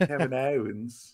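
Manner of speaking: slow, overdone Australian vowels; amateur Australian accent. Pretend. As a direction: stretch it into a put-on Australian accent. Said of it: "more practice with the Australian accent".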